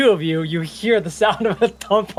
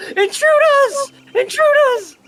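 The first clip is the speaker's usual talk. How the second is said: very high, loud, slow scream of alarm; girl shrieking a warning, high and loud; 7 dB louder, slower than usual. Acted. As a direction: shriek it out as she panics. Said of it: "and she screams".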